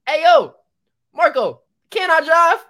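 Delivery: high, loud holler to a friend; acted call across the room, stretched out. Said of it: "They calling you".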